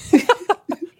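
high burst of real laughter. Real, not acted. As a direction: crack up at the joke. Laughs at co-host's teasing.

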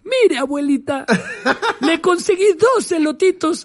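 put-on high, proud kid voice, laughing; a small boy showing off, giggling; little boy voice. Boy proudly reports to grandmother. (voz de niño orgulloso, con risa)

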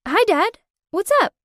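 high, loud and bright greeting; light up at hearing dad; excited. Acted. Daughter answering her dad's call, high and excited. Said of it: "I excitedly said".

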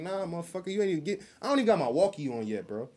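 heated retort. Retold or imitated. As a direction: bristle at the accusation.

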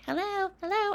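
high, thin call for attention; timid hosts calling. Retold or imitated. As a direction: squeak for the room's attention without power. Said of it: "So they were like".

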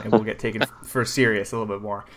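usual speaking voice

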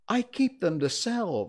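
high-voiced Hatter justifying himself; high, defensive explanation; explaining Hatter. A narrator's reading. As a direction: explain yourself hastily to the king in a high voice. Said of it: "added as an explanation".

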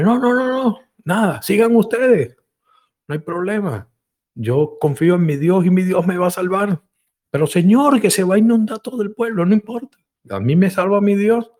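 very high insistent refusal; stubborn refusal. Acted. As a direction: cheerfully wave off the rescuers. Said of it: "Y decía el hombre".

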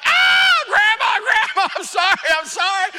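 loud, high yelping apology with a laugh; boy yelping sorry under the swatter; pleading yell. Retold or imitated. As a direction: yelp sorry while getting swatted. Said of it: "I'm like".

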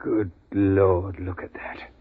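stunned, trailing off almost to a whisper; hushed horror. Acted. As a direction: take in a gruesome sight, appalled. Opens chamber, finds crushed remains.

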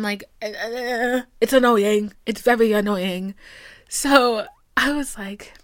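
normal talking voice

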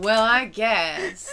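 loud, drawn-out fake whining; mock reluctance. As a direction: wail it slowly, hammed-up grudging. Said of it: "I'm like".

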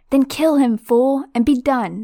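high, loud furious screech; Voldemort screaming at his servant. Acted. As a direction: shriek the order in rage. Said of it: "screeched Voldemort".

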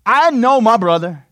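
slow, loud knowing line; the brother sure of his sibling; a hint of the bitter brother. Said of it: "the brother was like".

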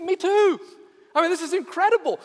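high, excited burst of joy; delighted. Acted. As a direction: gush with sudden delight. Excited rescuer finds common ground.

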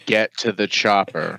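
put-on low, dejected action-hero line with no accent; mock depressed. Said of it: "getting more and more depressed".

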